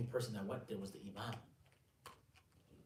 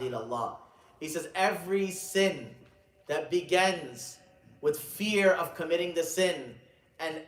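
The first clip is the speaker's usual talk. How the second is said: high, loud, emphatic sermon delivery; ringing, raised-voice exhortation; preaching at full force; 7 semitones higher, 12 dB louder than usual. Acted. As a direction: preach it out, leaning on key words.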